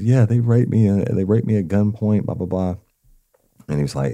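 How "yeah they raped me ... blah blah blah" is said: high, loud retelling of her upset reply; mock-distressed, tailing into a dismissive summary; imitated distraught wife. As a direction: raise the voice to mimic her outcry. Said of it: "she started crying".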